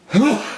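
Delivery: a sudden loud sneeze; sneezing. Real, not acted. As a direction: sneeze sharply mid-sentence. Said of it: "I'm gonna sneeze".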